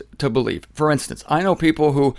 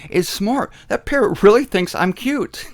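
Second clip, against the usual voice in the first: impressed guest; 6 semitones higher than usual. Retold or imitated. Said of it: "the person coming into the home thinks".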